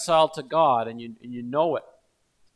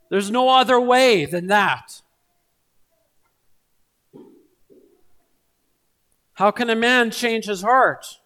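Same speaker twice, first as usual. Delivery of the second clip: louder, pressing sermon delivery, driving each question home; full-force preaching; 4 semitones higher, 6 dB louder than usual. Acted. Pastor urging the congregation to look to Christ, in louder full-force preaching. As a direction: preach it hard and insistent to the congregation.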